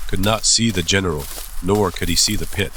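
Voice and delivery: plain reading voice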